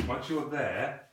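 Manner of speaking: deliberate, drawn-out caution; slow warning. Shown as a demonstration. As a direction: make the warning sink in. Coach stresses danger of pushing.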